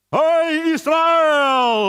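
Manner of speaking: slow, very loud, high call; giant's shout. Acted. Goliath calling out a challenge to Israel, slow booming shout. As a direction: bellow it across the valley. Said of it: "Goliat berteriak".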